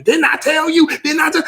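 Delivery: put-on high, loud scolding yell at the kids; mock yell. Parent yelling at a child who didn't listen, shouting. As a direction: snap at the child like a fed-up parent. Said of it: "but you don't have to say it like that".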